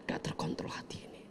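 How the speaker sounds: breathy whispered aside. Real, not acted. Preacher muttering about uncontrolled anger, whispered. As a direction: whisper it to yourself.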